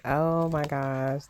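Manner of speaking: normal talking voice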